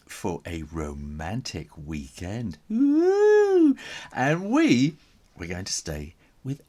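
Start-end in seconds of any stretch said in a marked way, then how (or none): 2.7-3.8 high loud teasing whoop; loud mocking woo. A narrator's reading. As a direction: whoop at them like a teasing kid. Mocking parents' romantic weekend.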